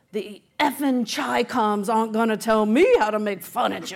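loud laughing Southern Virginia drawl imitation; half-laughing, do the grumpy Southern Virginia factory boss; gruff Southern Virginia accent, said with a laugh. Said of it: "deep Southern Virginia drawl".

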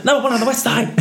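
high, singsong catchphrase; high-pitched character voice. Retold or imitated. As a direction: squeak the catchphrase out.

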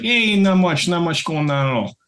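Brooklyn Italian imitation; Brooklyn accent. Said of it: "we talked like this".

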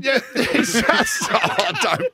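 slow laughing words. Real, not acted. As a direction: talk through laughter. Laughing teasing aside.

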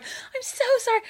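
put-on a little squeaky girl begging forgiveness. Girl caught peeing behind car apologises, squeaky voice. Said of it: "behind this car going".

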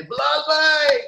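high, loud fan shout; shouting from balcony. Retold or imitated. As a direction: call out to players far below. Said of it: "I remember just shouting at them from the balcony".

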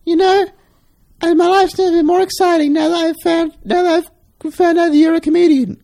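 put-on very high, breathy-free girlish voice; gushing woman. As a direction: gush it like a smitten young woman.

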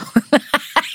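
high, delighted laugh; happy laugh. Retold or imitated. Consultant laughs at the mother's good news, delighted. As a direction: laugh with relief and joy.

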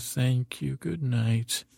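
ordinary talk

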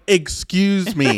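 slow, laughing excuse me; drawn out in mock offense; mock outrage. To a friend skipping taglines, slow mock outrage. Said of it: "And I was like".

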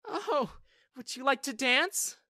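hammed-up tipsy invitation, high and slow. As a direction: play the drunk girl asking him to dance. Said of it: "she suddenly asked".

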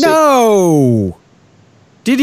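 high, drawn-out no that falls away; shocked exclamation. Real, not acted. Co-host hearing the London accent was bad, incredulous. Reacts to correction about accent.

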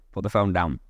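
ordinary talk

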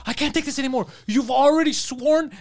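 high exasperated mock protest; imitated fighting. Shop worker fed up with customer, high mock-exasperated protest. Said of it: "they're fighting".

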